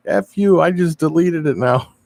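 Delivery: mock angry yell. Quoting the angry guy's reply.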